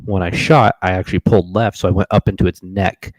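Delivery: normal talking voice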